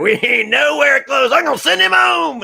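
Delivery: stretched-out, high mock holler; imitating the contestant. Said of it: "And she was like".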